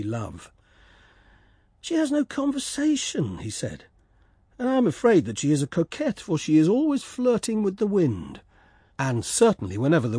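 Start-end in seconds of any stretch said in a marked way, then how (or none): 1.8-3.4 high, sulky little swallow complaining. Acted. Swallow grumbling about the reed, high and sulky. 4.6-8.4 lightly raised, quick swallow complaining. Acted. Swallow grumbling about the reed, quick and higher.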